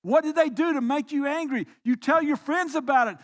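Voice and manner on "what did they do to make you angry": usual speaking voice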